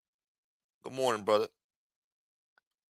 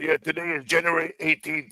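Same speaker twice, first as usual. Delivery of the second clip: mocking his video; 5 semitones higher than usual. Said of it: "he's doing a video talking about".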